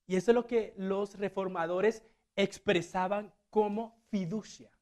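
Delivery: usual speaking voice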